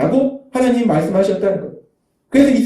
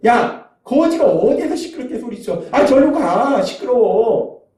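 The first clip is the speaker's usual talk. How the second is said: acted crowd shooing a beggar, higher pitch, last word drawn out; annoyed scolding; 4 semitones higher, slower than usual. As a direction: tell off a noisy nuisance. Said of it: "주변 사람들은 시끄럽다는 거예요".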